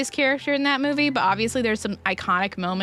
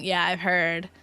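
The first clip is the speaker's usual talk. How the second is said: normal talking voice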